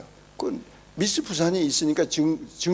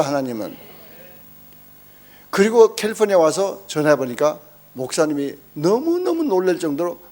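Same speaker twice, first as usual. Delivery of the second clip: normal talking voice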